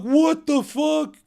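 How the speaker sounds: high, slow amazed what the fuck; stunned asking brother in disbelief. Retold or imitated. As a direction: draw it out in shock. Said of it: "I looked at my brother like".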